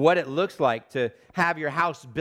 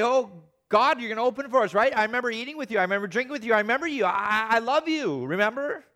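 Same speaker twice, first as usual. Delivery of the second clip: higher, coaxing plea to be let in; pleading believer at the door; 6 semitones higher, faster than usual. Acted. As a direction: beg God to remember you. The dead pleading at God's door.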